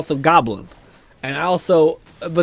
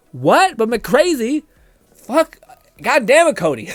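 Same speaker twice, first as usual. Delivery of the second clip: high, loud disbelief dropping at the name; mock shocked realisation; 7 semitones higher, 3 dB louder, slower than usual. Streamer recognising a renamed viewer, mock shocked. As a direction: blurt it out, pitch jumping up. Acted reaction to learning who it is.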